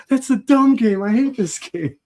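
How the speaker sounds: mock the child's pouty protest; imitating a cranky kid. Dad imitating his young daughter refusing his game, whiny. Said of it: "You go, you go".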